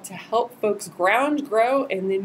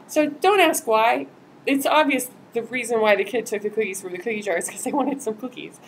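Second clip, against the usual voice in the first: ordinary delivery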